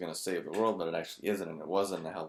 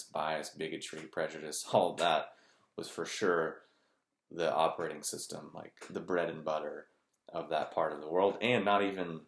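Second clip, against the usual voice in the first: ordinary delivery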